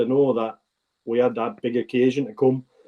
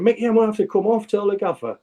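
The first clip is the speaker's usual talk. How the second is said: mild mock shout; 7 semitones higher than usual. Teammate shouting over from wing.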